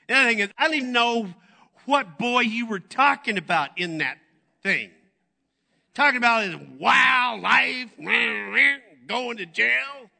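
high, loud, fast mother voice; mom's complaint. Retold or imitated. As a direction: rattle off the mom's grievances. Mother voiced in retold story.

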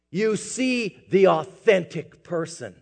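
ordinary talk

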